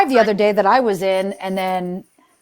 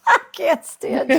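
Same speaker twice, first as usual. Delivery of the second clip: high, helpless laughing voice; 8 semitones higher than usual. Real, not acted. Laughing at a friend's joke about Bunny, through giggles. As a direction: squeeze the words out through laughter.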